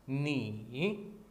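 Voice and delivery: नी with a dipping third tone. Shown as a demonstration. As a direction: bend नी down and up. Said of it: "third tone". (नी, third tone में)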